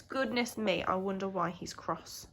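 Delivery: ordinary delivery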